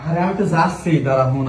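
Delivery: usual speaking voice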